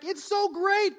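high, gushing delight; a giddy little squeal of joy; excited inside. Retold or imitated. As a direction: let it burst out like a thrilled kid. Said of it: "we're like".

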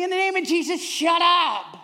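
loud comic shout; joking father shouts shut up; mock rebuke. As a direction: bark it like a preacher rebuking. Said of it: "I say it really nicely".

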